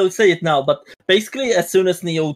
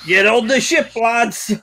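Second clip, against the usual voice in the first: mock shout with raised pitch; acted shout; 6 semitones higher, slower than usual. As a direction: call out as a sailor swinging down. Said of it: "he shouts".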